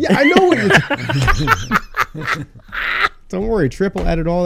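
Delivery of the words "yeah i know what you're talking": high, slow words through laughter; laughing while talking. Real, not acted. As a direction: talk while cracking up. Cracking up at friend.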